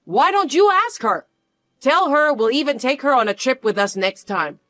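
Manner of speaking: smug and pushy, sure she'll win; mocking taunt. Mother-in-law taunting the mother with a smug, high sneer.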